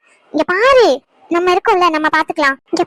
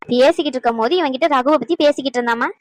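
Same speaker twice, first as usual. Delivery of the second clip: ordinary delivery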